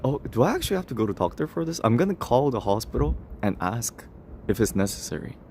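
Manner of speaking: ordinary delivery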